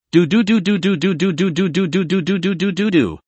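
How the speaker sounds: rapid, even "doo-doo-doo" phone tone; imitated ringing tone. A narrator's reading. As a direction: mimic a phone line ringing in a steady repeated pulse. Imitated dial tone before call connects.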